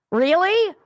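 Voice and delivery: mocking the host with a loud, high Really?; loud mock incredulity. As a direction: bark Really? in disbelief.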